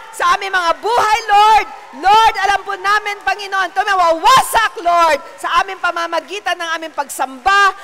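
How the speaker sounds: loud, high shouted praise, stretched out; preaching at full force. Acted. Preacher shouting praise to the congregation, full force. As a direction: rouse the whole church to shout.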